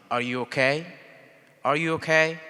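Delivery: ordinary delivery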